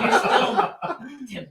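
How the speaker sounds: laughing. Real, not acted. Laughing mid-sentence.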